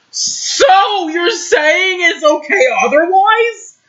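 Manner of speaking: high, loud disbelieving question; incredulous squeal. Real, not acted. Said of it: "outraged at own story".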